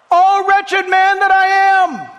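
Paul crying out in anguish; Paul's anguished cry. Acted. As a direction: wail it as a broken man. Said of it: "Hey, Paul, what do you got to say for yourself".

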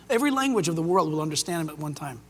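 ordinary talk, nothing marked

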